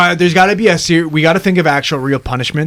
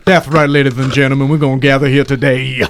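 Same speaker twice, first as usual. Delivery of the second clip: put-on reverend character voice; Leon Phelps as reverend; 4 semitones lower than usual. Shown as a demonstration. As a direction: slightly lower comic preacher voice. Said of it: "kinda like a Leon Phelps, but, like, a reverend".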